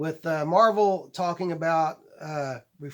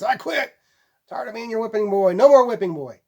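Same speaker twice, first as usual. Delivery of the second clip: defiant outburst, higher and louder; Hal Jordan; 6 semitones higher, 8 dB louder than usual. Acted. Comic-book hero telling the Guardians he quits, defiant and loud. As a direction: act out an exasperated hero's resignation, building louder. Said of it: "with Hal Jordan telling the Guardians".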